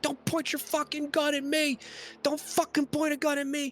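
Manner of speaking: high, heated warning yell; acted yelling. Game master as Jimmy facing a gun, high heated yelling. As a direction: yell it pitched far above normal. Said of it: "And they're yelling".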